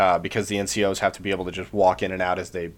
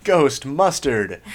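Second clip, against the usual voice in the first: drawn-out, higher mock yell; retold yell; 6 semitones higher, 4 dB louder, much slower than usual. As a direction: call it out like a chorus line, half-held back. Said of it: "you can hear him yell".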